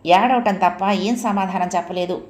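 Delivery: ordinary delivery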